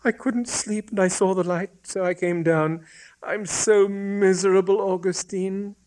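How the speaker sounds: put-on high-pitched girlish voice, plaintive; high, woeful female character; miserable young woman. A narrator's reading. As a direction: play an unhappy girl confiding her misery. Voices the girl in dialogue.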